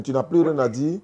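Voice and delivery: normal talking voice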